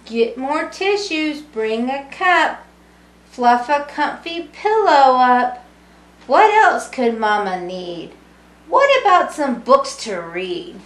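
lively sing-song storybook reading; reading to children. A narrator's reading. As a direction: read it warmly to a room of kids. Reading picture book to children.

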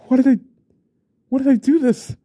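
high, loud horrified outburst; desperate loud cry; anguished cry. Acted. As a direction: cry out in panic at your own deed. Man horrified at what he did.

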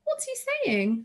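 put-on very high girlish question; high-pitched, eager young girl; anxious girl asking. A narrator's reading. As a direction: ask in a high child's voice. Daughter asks about upsetting telegram.

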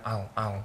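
"I'll" soft, will barely heard; swallowed will. Shown as a demonstration. Teacher demonstrates to Bell, will swallowed softly. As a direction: let the will disappear. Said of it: "거의 그냥".

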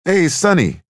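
high, loud hail to a buddy; hearty shout of hello; calling out a greeting. A narrator's reading. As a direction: call out across the doorway. Cork calls out at the door.

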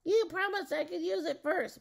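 high, loud protest; shrieking. A narrator's reading. Witch demands her promised turn with the staff, high shriek. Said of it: "Matilda shrieked".

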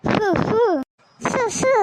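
two short puffs of breath; blowing. Acted. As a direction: blow on a burn to cool it. Blowing on burnt hands. (ஃபூ என ஊதுவது)

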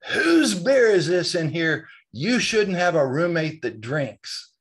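slow, loud, high outraged yell; mock furious dad shouting; retold scream. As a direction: bellow it out in shock and anger. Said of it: "I hear this scream".

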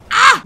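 very high, loud yelp; sudden piercing "ah!"; startled shriek. Real, not acted. Player startled mid-race, startled shriek.

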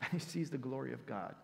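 ordinary talk, nothing marked

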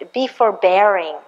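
ordinary talk

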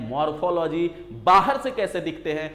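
normal talking voice

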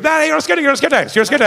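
high, rushed panicky calling out; hammed-up alarm, words tumbling out; mock frantic reveal. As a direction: imitate someone desperately calling off a prank. Imitates hosts frantically stopping the fight.

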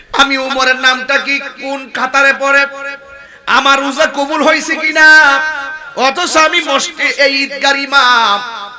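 loud, high chanted sermon delivery; full-force preaching. Real, not acted. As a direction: proclaim the story to a crowd. (উঁচু গলায় সুরেলা ওয়াজ)

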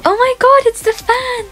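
high squeal of delight at spotting the fan; very high, thrilled outburst; excited squeal. Real, not acted. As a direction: shriek it like you've just seen a celebrity. Spots her fan, shrieks.

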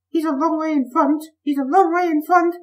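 very high, loud monotone wail. A narrator's reading. The coaches seeing Bertie in front, wailing. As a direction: wail it right at the top of the voice. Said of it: "wailed Annie and Clarabel".

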